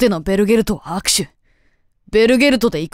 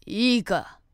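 usual speaking voice

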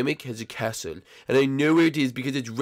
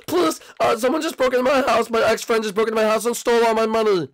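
high, urgent plea for help; frantic emergency call; 9 semitones higher than usual. Acted. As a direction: beg the police in a panic.

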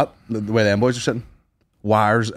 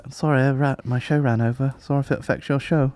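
a hint of a posh apologetic comic; lightly voiced plummy sorry; imitating the posh comic apologizing for running over; posh apology; 2 semitones higher, 4 dB quieter than usual. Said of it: "He's like".

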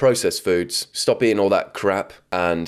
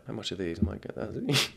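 timid shopper; 11 dB quieter than usual. Retold or imitated.

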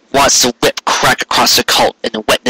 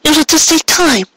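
put-on high young mare's voice, slow and soothing; young mare, gentle. A narrator's reading. Mare reassures hurt Carrot, high and gently slow. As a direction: voice her high and slow, consoling him.

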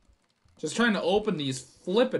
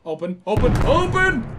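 louder and louder, last word a high yell; real desperate shout; frantic shouting; 7 semitones higher, 8 dB louder than usual. Real, not acted. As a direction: yell at the door to open. Frantic at locked door.

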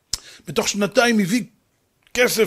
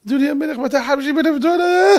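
high tearful wail, breaking at the end; mock sobbing; 6 semitones higher than usual. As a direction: wail the sum to the king and dissolve into sobs. Robbed man sobs the amount. (יללה בכיינית מוצגת)

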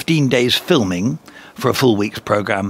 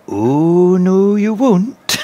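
high, drawn-out Scottish shopkeeper voice; slow, stretched Scottish refusal; Scottish accent; 9 semitones higher, 5 dB louder, much slower than usual. Retold or imitated. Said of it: "My best Scottish accent".

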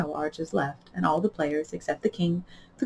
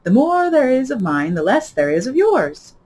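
higher, louder grand lady; Duchess voice; 7 semitones higher, 5 dB louder than usual. A narrator's reading. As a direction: moralize grandly. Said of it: "said the Duchess".

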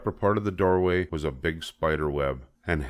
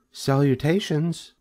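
high, measured greeting; small high-pitched spider hello; thin voice; 9 semitones higher than usual. A narrator's reading. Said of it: "the thin voice that addressed him".

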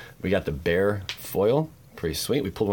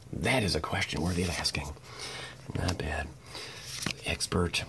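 whisper; 2 semitones lower, 8 dB quieter than usual. Acted. Whispered aside.

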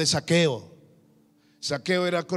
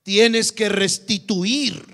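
louder and slow, each word of "Tienes que restituir" hammered; emphatic preaching; 2 semitones higher, 8 dB louder, much slower than usual. Real, not acted. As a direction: slam the "No" then drag out the command.

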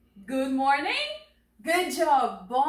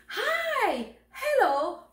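high, sing-out hello; cheerful greeting; 11 semitones higher, much slower than usual. Acted. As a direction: greet someone in a high, excited voice. Acts out greeting someone.

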